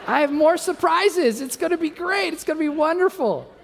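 very high, hammed-up enthusiasm; high-pitched mock-gleeful reassurance; overselling excitement. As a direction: play a desperate husband promising wonders. Overselling surprises to crying wife.

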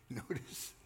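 usual speaking voice

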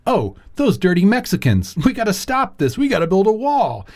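high-pitched mock outrage; mock alarm. Voicing the base hearing a dog whistle, mock alarm.

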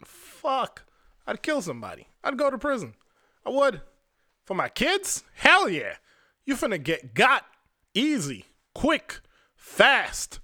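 real anger rising at the thought; getting mad. Real, not acted. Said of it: "mad just thinking about it".

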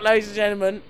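ordinary talk

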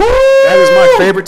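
very high, loud cheer; excited. Real, not acted. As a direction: celebrate the hot take loudly. Celebrating hot take.

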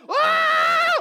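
very high loud yell; battle yell. Retold or imitated. Jesus storming the temple with a yell. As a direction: charge in with a war cry. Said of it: "he just comes in all just".

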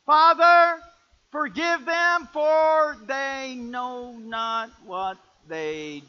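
loud, high, drawn-out and solemn; solemn Jesus quote. Shown as a demonstration. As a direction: deliver the true words slowly with weight. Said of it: "No, what did he say?".

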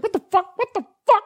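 very high parrot squawk; imitating swearing bird. Acting out the bird video in a high squawk. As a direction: squeal it repeatedly like a parrot. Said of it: "He's like".

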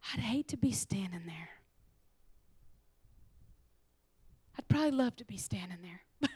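confiding whisper. Real, not acted. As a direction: admit it quietly to the crowd. Whispered confession.

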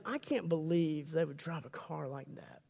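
a gossip sneering at the neighbours' new purchases; catty gossip. Acted. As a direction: whisper it like judging neighbours behind their backs. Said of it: "what's behind that is".

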